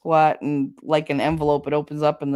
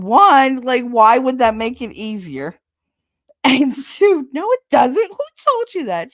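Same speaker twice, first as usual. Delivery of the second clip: much higher, louder disbelieving doctor; incredulous doctor; 7 semitones higher, 7 dB louder than usual. Retold or imitated. Doctor challenging Kate's belief, loud and incredulous. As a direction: exclaim in disbelief at the nonsense.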